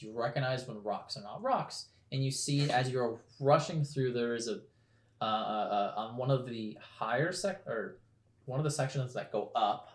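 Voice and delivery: ordinary talk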